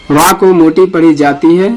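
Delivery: normal talking voice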